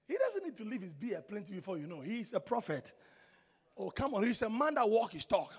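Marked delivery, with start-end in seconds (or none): none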